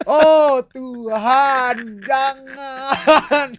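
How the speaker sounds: high, stretched-out wail of dismay; exaggerated horrified plea; mock horror. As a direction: wail it out loud in hammed-up dread. Horrified reaction to dad's plan.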